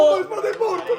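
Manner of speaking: high, fast exclamation; excited shout. Real, not acted. Reacting as the Spada horse drops out, excited shout. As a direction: cry it out high and quick.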